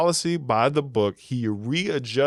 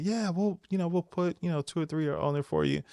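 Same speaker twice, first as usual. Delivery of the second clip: soft high reply; 6 semitones higher, 6 dB quieter than usual. Retold or imitated. Voicing his reply to the lady.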